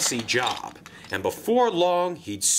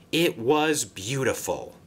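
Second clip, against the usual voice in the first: plain reading voice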